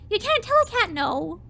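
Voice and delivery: usual speaking voice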